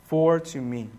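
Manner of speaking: low, soft reply after a storm of questions; hushed answer. Acted. Preacher as Paul answering the doubter, hushed and low. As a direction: drop the voice and answer simply.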